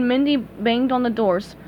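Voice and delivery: usual speaking voice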